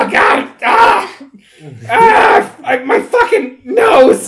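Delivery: high, loud cries of agony; pained shout. Acted. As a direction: yell out as if your nose just broke. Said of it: "and he's like".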